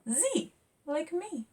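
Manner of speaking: put-on high proud little girl; pleased girl. Zora noticing the Z, proudly high. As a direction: delight at her own letter.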